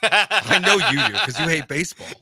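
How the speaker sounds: laughing through the words; teasing laugh. Real, not acted.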